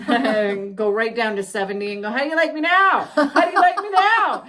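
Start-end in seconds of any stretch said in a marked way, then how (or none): 2.1-4.4 high, loud gleeful taunt. Retold or imitated. Driver taunting the car behind, loud mocking yell.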